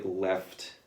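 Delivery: ordinary talk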